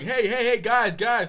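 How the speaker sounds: calling out. Retold or imitated. Teacher calling out to stop two boys arguing.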